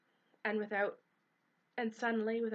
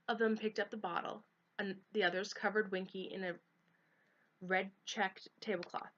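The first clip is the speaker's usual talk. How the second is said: ordinary delivery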